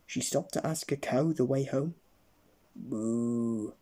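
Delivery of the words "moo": low lowing moo; cow moo. Acted. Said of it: "replied the cow".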